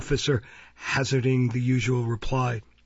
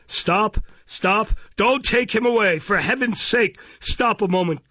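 high, desperate plea; crying out in haste, pitched high; urgent breathless cry; 8 semitones higher, 4 dB louder than usual. A narrator's reading. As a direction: burst in and beg them to stop. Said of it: "breathless with haste".

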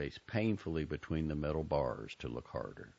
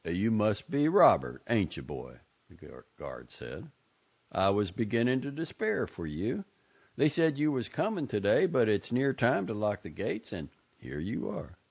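a louder folksy guard; lightly voiced drawling guard; 5 semitones higher, 5 dB louder than usual. A narrator's reading. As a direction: greet the boy at the gate.

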